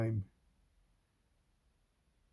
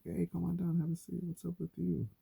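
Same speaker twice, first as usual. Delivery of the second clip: high, eager pickup line; mock-eager flirt, pitched up; 8 semitones higher than usual. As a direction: come on strong to a pretty woman. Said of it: "be like".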